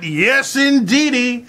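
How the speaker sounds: ordinary talk, nothing marked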